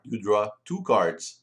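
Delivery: ordinary delivery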